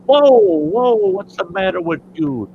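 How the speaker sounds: loud, high startled protest; startled whoa. Acted. Woman in the joke stops the farmer with a startled, loud whoa. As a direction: pull back in alarm, voice jumping up.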